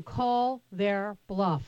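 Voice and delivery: slow, deliberate words; emphatic urging. Real, not acted. Host backs caller's idea of a tougher deal, slow and emphatic. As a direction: spell it out slowly, word by word.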